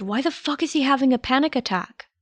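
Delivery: ordinary delivery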